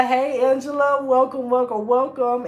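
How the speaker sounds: high, loud, delighted welcome; excited greeting. Real, not acted. Host spots Angela joining the live stream, excited greeting. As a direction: greet a friend with a real burst of excitement.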